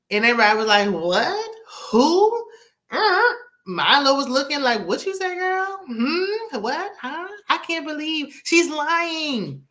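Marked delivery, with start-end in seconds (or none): 0.9-3.2 high, incredulous questions rising sharply; mock shock. Retelling everybody's shocked reaction. 4.8-9.6 high-pitched, scandalized questioning; mock disbelief. Mimicking Milo's disbelieving look.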